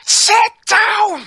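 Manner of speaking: high, loud yelled command; shout. Pretend. Movie watcher yelling at characters who can't hear, loud shout. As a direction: shout it so a far-off listener hears. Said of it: "have to shout louder then".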